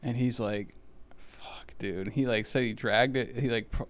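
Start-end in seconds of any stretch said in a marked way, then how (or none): 1.2-2.1 breathy whispered swear, softer; whispered curse. Retold or imitated. Buddy staring at the half-crushed cat, whispered curse. Friend sees the run-over cat.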